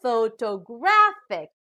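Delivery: "photographic" slowly, with GRAPH punched loud; "photographic" drawn out, leaning hard on GRAPH; stress on third syllable. Shown as a demonstration. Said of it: "stress is on the third syllable".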